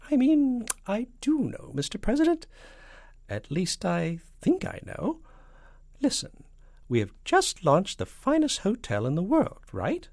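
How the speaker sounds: high, hedging spy voice; falsetto. A narrator's reading. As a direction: bluff your way through in falsetto. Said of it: "and a falsetto voice".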